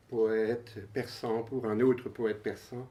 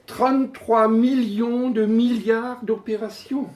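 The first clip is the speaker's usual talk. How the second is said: high-pitched, emphatic recitation; poem declamation; 11 semitones higher, 8 dB louder, slower than usual. Acted. Poet proclaims staggering computer figures to the audience, declaimed. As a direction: proclaim each figure with suspenseful pauses.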